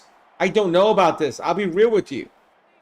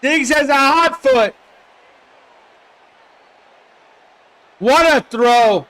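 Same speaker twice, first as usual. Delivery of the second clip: much higher and louder, slow drawn-out yell; touchdown shout; 6 semitones higher, 4 dB louder, slower than usual. Real, not acted. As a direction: erupt like a commentator on a score. Touchdown scored live.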